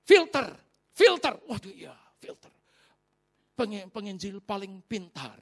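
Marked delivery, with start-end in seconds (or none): 0.0-1.4 high, loud, slow repetition; urgent reminder. Real, not acted. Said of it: "Roh Kudus kasih ingat". 1.5-2.5 whispered, calming down; whispered to himself. Real, not acted. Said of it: "calming himself".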